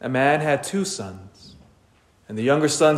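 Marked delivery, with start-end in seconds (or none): none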